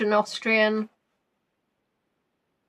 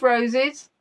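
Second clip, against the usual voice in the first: normal talking voice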